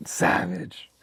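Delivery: whispered approval. Real, not acted. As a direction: breathe it out, impressed. Reacting to "we're savages.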